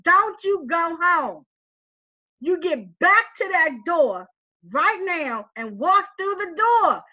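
slow, firm, spaced-out command; stern cousin order. Retold or imitated. Cousin on the phone ordering her to walk through the door, slow and firm. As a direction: lay down the law word by word.

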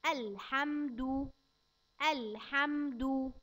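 الحمد said slowly with a clear u on the د, alhamdu; damma on dal. Shown as a demonstration. Teacher showing a child the damma on dal. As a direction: stretch الحمد and round the د to du. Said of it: "ركز كده معايا على حرف الدال، نضمه".

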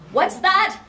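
high, very loud demand; demanding mother. A narrator's reading. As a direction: shout it suspiciously down the phone. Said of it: "her mother asked".

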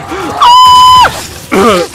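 very high, loud gasping shriek; long high cry of shock; startled scream. Real, not acted. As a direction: gasp loudly in sudden fright. Jump scare in game.

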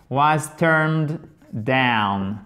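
very slow, deliberate was turned down; slow model reading. Shown as a demonstration. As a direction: spell it out slowly for students. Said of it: "si vous avez un doute sur l'écriture". (lecture très lente et articulée)

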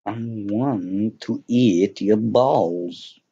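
deliberate, lingering mock declaration; slow, drawn-out joke. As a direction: stretch every word out very slowly. Joke line drawn out slowly.

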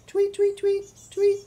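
very high, quick chirps; bird tweet. Pretend. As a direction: chirp "tweet" like little birds. Birds in picture book.

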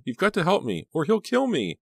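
very high, frantic plea; acted panic, pitch leaping up; desperate cry. A narrator's reading. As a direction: beg for your life. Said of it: "she cried".

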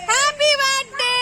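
high, drawn-out greeting; birthday cheer. Real, not acted. Greeting sister on her birthday, calling out. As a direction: call it out high and long.

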